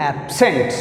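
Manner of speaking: "absent" with weight on SENT; ab-SENT, "absent" in teaching demo; stress on second syllable. Shown as a demonstration. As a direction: press the end of "absent". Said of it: "stress on scent".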